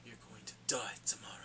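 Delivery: icy whispered threat; chilling whispered warning; cold whisper. Retold or imitated. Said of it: "she was like".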